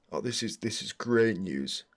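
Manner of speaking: ordinary talk, nothing marked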